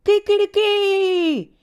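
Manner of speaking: very high, drawn-out cock-a-doodle-doo; rooster crow. A narrator's reading. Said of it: "una voz que gritaba". (canto de gallo, muy agudo y alargado)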